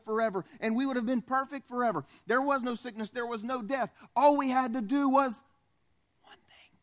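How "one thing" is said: whispered emphasis. Real, not acted. Climactic whispered punchline.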